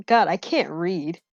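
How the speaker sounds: ordinary talk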